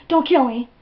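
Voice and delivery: loud, high panicked plea; acted terrified begging; freaking out. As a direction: cry out for mercy, hammed up. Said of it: "I'm, like, freaking the fuck out".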